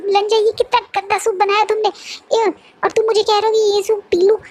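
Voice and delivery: high, squeaky yuck at the taste; a spoiled boy whining about foul soup; disgusted. Acted. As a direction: recoil from the nasty soup, voice jumping up. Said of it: "एकदम से कहता है".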